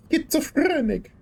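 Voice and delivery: very high voice, long rolled R; high, showy trill on "Schizophrenic"; Linda. Acted. Channeling someone for fun, high trilled voice. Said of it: "just channeling Linda now".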